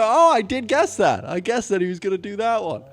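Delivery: high, loud smug listener voice; imitating a listener. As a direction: chirp it like a know-it-all fan. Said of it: "You go".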